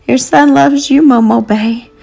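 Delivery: a son grieving over his mother; sobbing. A narrator's reading. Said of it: "he sobbed".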